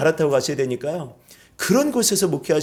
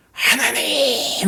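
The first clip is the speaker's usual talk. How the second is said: high, long-drawn call; crying out; 8 semitones higher, much slower than usual. Shown as a demonstration. Preacher showing congregation how to cry to God, calling out. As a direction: cry out to God, stretching the word. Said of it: "크게 한번 소리 지르시고".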